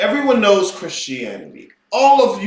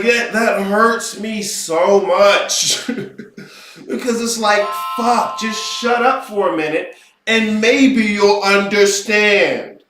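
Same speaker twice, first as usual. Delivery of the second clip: ordinary talk, nothing marked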